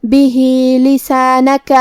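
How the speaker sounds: slow, drawn-out به لسانک; slow recitation. Shown as a demonstration. Teacher recites به لسانک slowly for students. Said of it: "recites word for the lesson".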